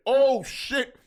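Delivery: loud, high burst of amazement; surprised exclaim. Retold or imitated. Arriving on the packed roof, amazed and loud. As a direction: blurt it out, stunned by the scene. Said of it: "I said".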